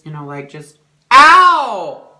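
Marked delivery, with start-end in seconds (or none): 1.1-2.1 high, piercing "ow"; loud pained yelp. Real, not acted. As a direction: cry out sharply in pain. Sudden yelp of pain.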